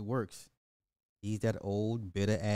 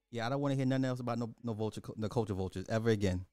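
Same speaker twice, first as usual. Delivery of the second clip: ordinary talk, nothing marked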